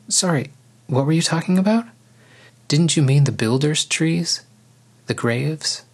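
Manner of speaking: lifted pitch, genuinely lost in the conversation; confused. A narrator's reading. Arya confusedly asking Simon what he meant.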